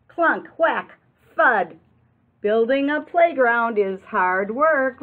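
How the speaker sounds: loud, punchy sound words; building noises. A narrator's reading. Reading building noises to children, loud and punchy. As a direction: hammer out each noise.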